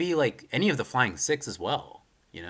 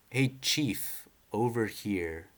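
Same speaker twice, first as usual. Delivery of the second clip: character voice; much slower than usual. Retold or imitated. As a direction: give the caller a different voice. Reading a manga character's call.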